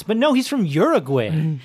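normal talking voice

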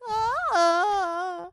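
high cracking yelp; like a teen boy's voice cracking; squeaky teen yelp. Retold or imitated. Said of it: "their voice was squeaking".

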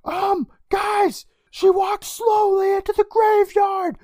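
very high, slow squealing shout; shouty Kristen impression. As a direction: squeal it out, drawn out. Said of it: "And Kristen's like".

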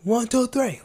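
high-pitched demo yell; mock scream. Shown as a demonstration. Host demonstrates screaming what you read, mock scream. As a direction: yell it out like reading at full volume. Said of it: "as loud as you can, like, you scream it".

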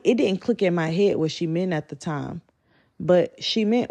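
usual speaking voice

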